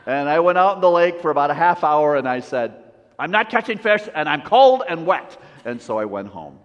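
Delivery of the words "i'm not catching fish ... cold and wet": loud, higher-pitched mock grumbling; comic indignant outburst; exasperated complaint. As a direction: act out being fed up. Retold exasperated complaint while fishing.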